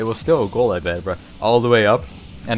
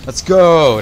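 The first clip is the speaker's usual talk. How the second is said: loud, higher shout of triumph; hype shout; 7 semitones higher, 10 dB louder than usual. Real, not acted. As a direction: yell it to celebrate the goal. Celebrating a goal.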